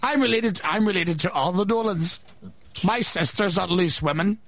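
put-on wandering Scottish-ish accent. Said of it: "You seem to be Scottish now".

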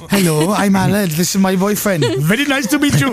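put-on broad comic Indian accent, rising at the end; sitcom Indian accent. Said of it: "very stereotype B B C sitcom Indian man".

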